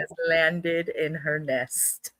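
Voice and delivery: slow, low mock ceremonial announcement; hammed-up slow low proclamation. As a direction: announce it like a grand arrival.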